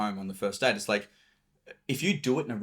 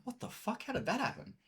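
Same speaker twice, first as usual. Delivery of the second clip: mock disbelief; 13 semitones higher, 8 dB quieter than usual. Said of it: "You're like".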